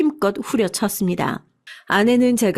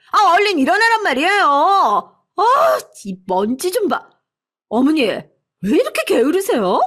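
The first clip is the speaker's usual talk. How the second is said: angry shouting wife; 8 semitones higher than usual. A narrator's reading. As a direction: yell down at the lazy old woman. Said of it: "아내의 화난 목소리가 온 집안에서 쩌렁쩌렁". (시어머니를 꾸짖는 며느리의 높은 고함)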